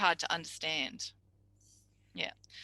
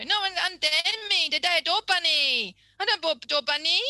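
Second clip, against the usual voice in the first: put-on much higher and louder, rushing child "door bunny"; a frantic kid pleading; upset excited child; 10 semitones higher, 6 dB louder than usual. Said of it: "come running up to you and go".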